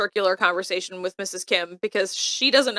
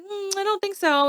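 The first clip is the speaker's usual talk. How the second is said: dismissive refusal; 10 semitones higher than usual. Acted. Voicing Emily refusing the phone request.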